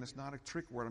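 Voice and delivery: ordinary talk, nothing marked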